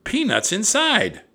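very high, loud announcement; thrilled high-pitched girl; excited cry. A narrator's reading. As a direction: cry out the good news. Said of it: "cried Annie".

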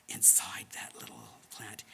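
near-whisper, low and soft; hushed. A narrator's reading. Telling children about the flower's night inside the plant, hushed. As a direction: drop into a hushed storytelling voice.

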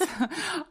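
short high giggle. Real, not acted. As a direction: giggle at the sudden topic change. Laughing at the topic jump.

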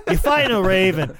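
same Boston fan, pulled back a notch, laughing. Pretend. Host repeats the Revere fan line, lighter laughing Boston accent. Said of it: "why don't you watch The Town".

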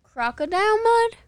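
high, loud, shocked question; shocked disbelief. Real, not acted. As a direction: blurt it out in disbelief. Shocked at crocodile facial.

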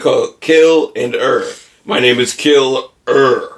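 Kill Er in two slow, separate pieces; Kill, then Er, drawn out; syllables split apart. Shown as a demonstration. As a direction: break killer into Kill and Er. Splitting killer into a name.

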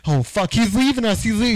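hammed-up panic as he walks off; panicked passengers. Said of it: "everybody's like".

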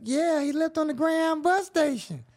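a grandma who expects trouble from her grandson; old grandma voice. Retold or imitated. Grandmother answers the cop's call, weary high old-lady voice.

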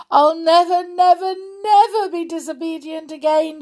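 high, loud wail of regret; narrated sobbing. A narrator's reading. Goldilocks telling her father and mother, acted sobbing. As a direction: wail the promise like a sorry child. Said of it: "she sobbed".

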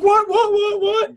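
high squeaky repeated what; flustered panic. Retold or imitated. Kazuya flustered by question.

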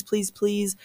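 ordinary talk

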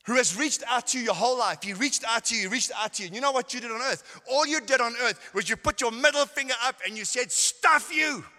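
loud, high preacher's roar; fiery preaching shout. Retold or imitated. Preacher retelling his sermon, shouting at the sinner. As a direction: thunder it at the crowd.

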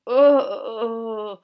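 loud, high shriek of horror; shocked squeal. Acted. Girl squealing at the thought of marrying him. Girls shrieking at a silly idea.

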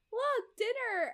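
high, loud, drawn-out mock cheer; eager presenting. Retold wife presenting dinner to her husband, eager. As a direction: sing it out brightly, stretched and high. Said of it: "Verity was all like".

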